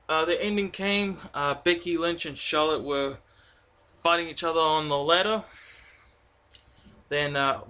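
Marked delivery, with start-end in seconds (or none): none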